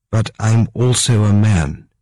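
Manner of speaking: ordinary delivery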